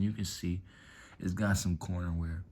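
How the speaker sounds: ordinary delivery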